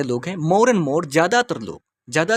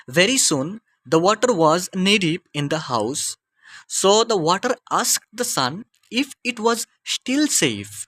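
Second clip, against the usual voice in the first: slow, measured classroom story reading; deliberate teacher's reading pace; slow read-aloud; much slower than usual. Acted. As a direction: read it out slowly, phrase by phrase for learners. Reads the story passage aloud.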